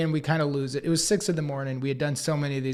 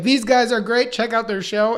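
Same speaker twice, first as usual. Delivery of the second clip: imitating the cheery anchor; 6 semitones higher, 5 dB louder than usual. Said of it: "she'd be like".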